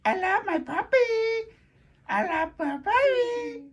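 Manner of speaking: high, crooning coo. Real, not acted. Owner gushing to her puppy in a high croon. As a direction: gush over the puppy.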